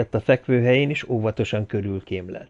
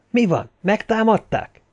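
startled; 11 semitones higher than usual. Acted. Ferguson startled awake.

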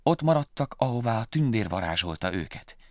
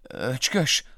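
puzzled; 4 semitones higher, 4 dB louder than usual. Acted. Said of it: "nyögte Willy".

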